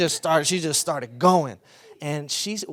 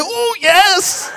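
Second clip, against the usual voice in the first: loud, much higher, laughing swoon; hammed-up rapture in an embrace; ecstatic praise; 13 semitones higher, 11 dB louder than usual. Imitating the woman hugging the pastor in the Spirit, high and loud. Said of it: "she's like".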